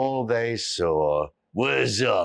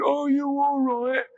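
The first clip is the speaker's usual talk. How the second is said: put-on concerned old man; 12 semitones higher than usual. A narrator's reading. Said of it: "the old man said".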